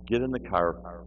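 ordinary talk

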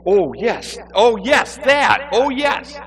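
high, loud, put-on recollection; flustered stalling. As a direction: stall with mounting fake certainty.

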